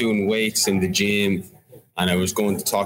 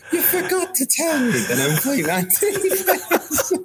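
incredulous. Retold or imitated. Retelling disbelief at manager.

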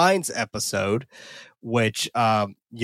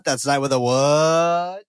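"what" drawn way out, rising; call-and-response cue; 4 dB louder, slower than usual. Pretend. Host cues co-host to spell the site, long rising "what". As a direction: stretch "what" upward until someone answers. Playful call for co-host's answer.

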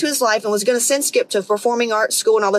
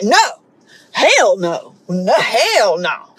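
mock men's drawn-out, indignant refusal; imitating a man flatly refusing to admit fear; emphatic denial; 3 dB louder, much slower than usual. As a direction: deny it hard, swooping on each "hell". Acts out men denying fear.

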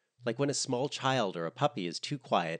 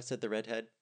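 usual speaking voice

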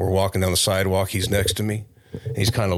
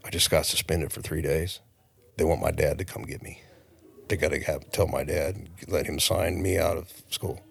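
soft, lowered voice on the phone; talking real light; 3 semitones lower, 6 dB quieter than usual. Retold or imitated. Suspended kid asking for dad to come, talking real light. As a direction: keep it down so nobody nearby overhears. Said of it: "I'm talking real light".

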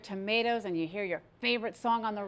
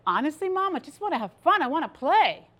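high-pitched daughter answering her mom; young self pleading; 6 semitones higher, 4 dB louder than usual. Retold or imitated. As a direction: plead upward like a playful kid. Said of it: "I said".

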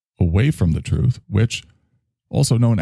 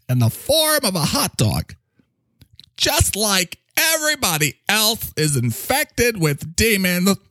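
a lightly raised, quirky figure's voice; higher character voice; 9 semitones higher than usual. Acted. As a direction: play an odd, self-serious character.

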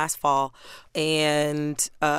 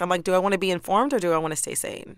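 ordinary talk, nothing marked

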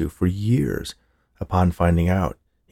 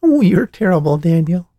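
giggling; 12 semitones higher, 7 dB louder than usual. A narrator's reading. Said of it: "Grandma giggled".

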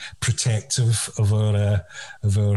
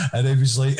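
usual speaking voice

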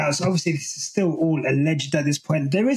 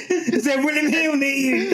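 mock questioning; 7 semitones higher than usual. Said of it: "She said".

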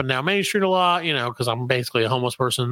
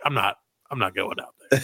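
lazy dog voice; 7 semitones lower, 3 dB quieter than usual. Retold or imitated. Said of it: "the dog's like".

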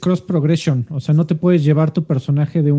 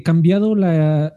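ordinary delivery

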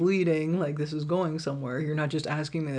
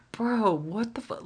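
incredulous; 6 semitones higher than usual. Retold or imitated. Reacting to absurd offer.

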